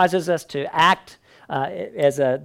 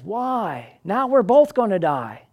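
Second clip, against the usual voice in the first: high, anguished cry of a betrayed frog; desperate frog; 6 semitones higher, slower than usual. Acted. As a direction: frog calls out in dismay as they sink. Frog voiced in fable.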